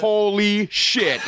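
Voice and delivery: very high, drawn-out amazement; starstruck awe. Retold or imitated. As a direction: stretch each word in mock disbelief. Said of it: "the most starstruck I had ever seen".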